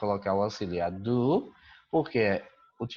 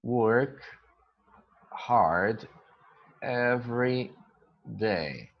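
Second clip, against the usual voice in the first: each word spaced out and slow for students to copy; slow dictation; much slower than usual. Shown as a demonstration. Teacher to students copying the sentence, slow word-by-word dictation. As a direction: spell it out for the class word by word. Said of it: "Então, a gente diz".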